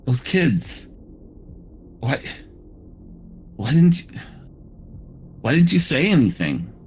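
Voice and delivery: upset accusing. Acted. Upset confrontation at hotel door.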